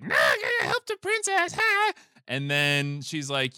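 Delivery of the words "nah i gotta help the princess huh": extremely high cartoon squawk; hammed-up shrieky mushroom voice; Toad. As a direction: squeal it like a tiny frantic sidekick. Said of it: "And then Toad's like".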